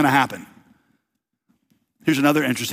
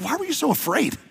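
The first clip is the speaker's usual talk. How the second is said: mock incredulous question; 10 semitones higher, 4 dB quieter than usual. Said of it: "he's like".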